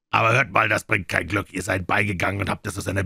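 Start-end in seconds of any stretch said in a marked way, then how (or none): none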